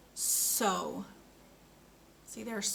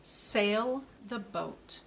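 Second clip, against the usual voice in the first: "Sail the boat" said very slowly, each word apart; slow model sentence; much slower than usual. Shown as a demonstration. Speech therapist models a sentence for a child, slow and careful. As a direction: lay it out slowly for the learner. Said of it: "That's our sentence".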